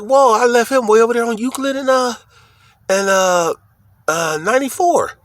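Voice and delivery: retold amazement, pitched well up; a high, disbelieving whoa at the truck; surprised. Telling how he spotted the truck again, high surprised voice.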